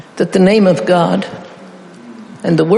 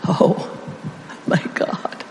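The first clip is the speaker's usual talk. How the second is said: whispered, breathy plea to God; desperate whisper; 9 dB quieter, slower than usual. Shown as a demonstration. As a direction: breathe it out like a prayer in need. Praying in real need.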